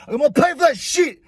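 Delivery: high, acted-out angry refusal; retold indignant outburst; raging. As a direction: re-enact digging in over the bill. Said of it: "I start raging".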